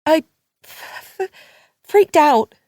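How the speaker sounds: high, loud stammer on freaked; stuttering. A narrator's reading. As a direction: stumble over the words. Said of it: "stuttering out the truth".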